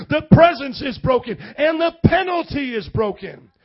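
preaching at full force. Acted. Preacher to the congregation, shouting the climax. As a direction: thunder it out over the congregation.

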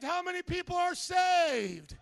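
normal talking voice

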